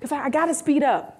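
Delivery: high, eager welcome; lightly voiced eager self; eager reply. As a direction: gladly accept the visit. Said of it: "her eager reply".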